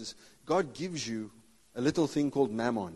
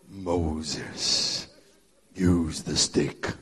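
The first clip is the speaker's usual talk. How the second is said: lower, slow, booming voice of God; deep God voice; 11 semitones lower, much slower than usual. Retold or imitated. God telling Moses to use the stick, deep and slow. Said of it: "this deep God voice".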